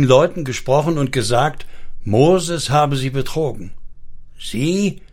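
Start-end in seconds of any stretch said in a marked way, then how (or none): 4.4-5.0 kurze, höher angesetzte Gegenfrage; leicht knurrig nachfragen; geknurrte Rückfrage. A narrator's reading. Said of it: "knurrte Uri".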